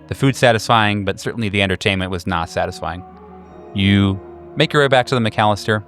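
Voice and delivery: normal talking voice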